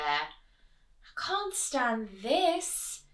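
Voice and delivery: fed-up Mister Bear voice; exasperated bear. A narrator's reading. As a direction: spell out his despair word by word. Bear fed up, cannot sleep.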